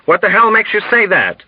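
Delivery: high, indignant demand; angry shouting. A narrator's reading. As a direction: challenge him furiously. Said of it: "shouted angrily at Dunbar".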